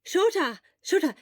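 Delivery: loud urgent calls of the name; calling his name loudly, worried. Acted. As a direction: shout the name to wake him. Friend calling out to him.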